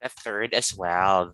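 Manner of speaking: ordinary talk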